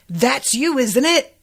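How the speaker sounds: accusing. Retold or imitated. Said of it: "accusing voice".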